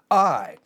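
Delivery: I punched out loud and hard; a loud, stressed I; emphatic I. Shown as a demonstration. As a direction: lean hard on the word I. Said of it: "it's the emphatic sense here".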